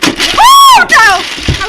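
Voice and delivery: very high, loud cry of dismay; dismayed shriek. Real, not acted. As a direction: wail as the pieces pop. Pieces pop before finishing.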